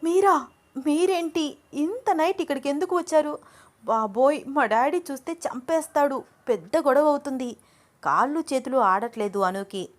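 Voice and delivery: high, startled and panicky; shocked. A narrator's reading. Girl startled by lover at night, alarmed. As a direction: gasp it out in alarm at the intruder. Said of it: "చాలా shock అయిపోతూ".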